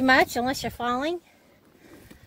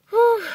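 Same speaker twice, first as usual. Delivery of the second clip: breathless whew; 11 semitones higher, 7 dB louder than usual. Real, not acted. Winded after the hill walk.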